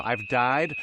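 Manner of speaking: ordinary talk, nothing marked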